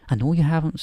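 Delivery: put-on a slightly overdone Scottish accent, briskly; Scottish McGonagall. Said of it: "almost bad Scottish accent".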